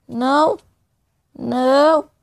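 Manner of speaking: loud cry of dismay; dismayed shout. Real, not acted. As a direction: yell out in frustration. Losing gear in game. (grito de desespero)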